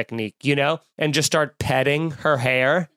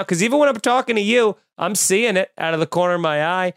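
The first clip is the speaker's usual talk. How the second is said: usual speaking voice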